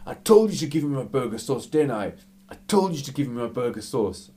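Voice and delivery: fast, insistent mock telling-off; acted angry customer. Angry burger customer to the staff, mock-indignant. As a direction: imitate a man angrily repeating his complaint.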